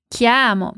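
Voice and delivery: "Chiamo" with a wide open a. Shown as a demonstration. Teacher showing the open a in "Chiamo" to students. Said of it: "com o A bem aberto".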